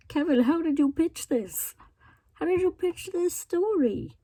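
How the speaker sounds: high-pitched, baffled questioning; mock interrogation. Questioning the reviewer about the story, mock incredulous. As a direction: demand an explanation in playful disbelief.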